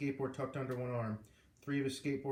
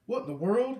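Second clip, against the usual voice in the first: high, loud wheezy exclaim; wheezing; 9 semitones higher, 8 dB louder than usual. A narrator's reading. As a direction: gasp it out winded. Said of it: "he wheezed".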